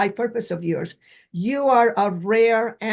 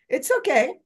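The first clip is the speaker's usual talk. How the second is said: mouse voice; 5 semitones higher than usual. Real, not acted. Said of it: "You sound like a mouse".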